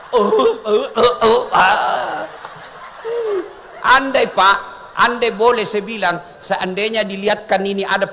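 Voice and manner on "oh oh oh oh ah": comic, broken moans of pain; mock pain groans. Battered official finally reaches heaven, mock groans of pain. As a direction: groan in short bursts, hammed-up.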